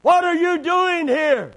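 high, loud, drawn-out demand; booming rebuke. Acted. God confronting Elijah in the cave, loud rebuke. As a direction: shout it as a stern challenge, nothing small about it. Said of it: "what he said in that still, small voice".

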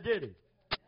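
usual speaking voice

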